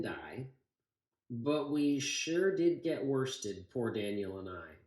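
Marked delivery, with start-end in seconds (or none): none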